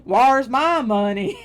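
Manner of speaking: put-on loud, slow, drawn-out Appalachian accent; Mount Vernon accent. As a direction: holler it in a hammed-up Appalachian accent. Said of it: "doing my Mount Vernon, uh, accent".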